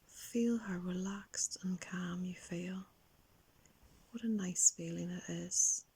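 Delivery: guided relaxation. Acted. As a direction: gently lead a young child into calm, lingering on key words. Leads children's guided relaxation.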